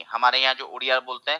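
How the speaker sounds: ordinary talk, nothing marked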